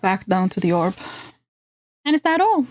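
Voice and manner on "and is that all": much higher, squeaky impatient elf; squeaky creature snapping orders. Acted. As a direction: squeak it out, busy and curt. Voices the elf woman.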